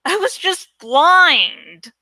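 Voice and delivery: ordinary delivery, nothing marked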